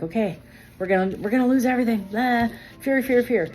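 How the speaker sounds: mock panic, pitched high; hammed-up husband fretting over losing everything; imitated freak-out. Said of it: "he's freaking out".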